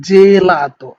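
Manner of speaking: জেলাতো with an open "e" on the first syllable, firm; correct Italian pronunciation. Shown as a demonstration. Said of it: "এ এর দিকে যাচ্ছে".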